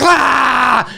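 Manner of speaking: imitated kid's wail; long comic bawl; mock child scream.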